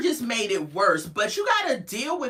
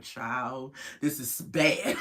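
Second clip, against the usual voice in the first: much quieter and lower, a hushed aside; friends murmuring; 10 semitones lower, 6 dB quieter, much slower than usual. Retold or imitated. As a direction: mutter it to each other in the front row. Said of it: "They was like".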